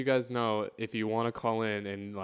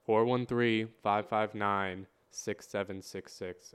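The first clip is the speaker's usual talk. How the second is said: slow dictation; slower than usual. Shown as a demonstration. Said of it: "Get your pens out".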